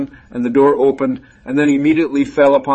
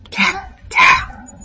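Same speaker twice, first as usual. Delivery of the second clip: imitated knocking. Wolf knocks at the door.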